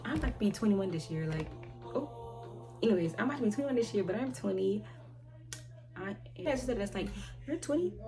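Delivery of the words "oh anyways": ordinary talk, nothing marked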